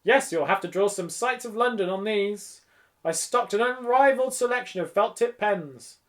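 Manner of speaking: lively, effusive shop talk; chirpy shopkeeper. A narrator's reading. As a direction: sell it proudly, then ask with curiosity. Voices the shopkeeper.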